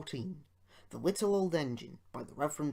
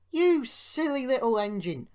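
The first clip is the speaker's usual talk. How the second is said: laughing; 10 semitones higher, 9 dB louder than usual. A narrator's reading. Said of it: "laughed his driver".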